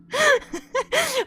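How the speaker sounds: squeaky laugh after a scare; high breathless laugh. Real, not acted.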